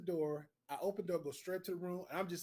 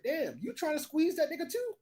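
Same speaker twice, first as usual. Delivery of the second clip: high-pitched disbelieving question; incredulous; 11 semitones higher, 3 dB louder than usual. Retold or imitated. Teller confronting roommate over his cousin, incredulous.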